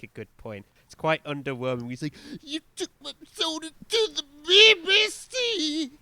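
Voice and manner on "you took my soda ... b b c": high, loud, drawn-out mock rage; hammed-up impression of a singer trying to sound furious; mock angry singer. Co-host mimicking an underwhelming song lyric, mock angry. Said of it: "like, really angry".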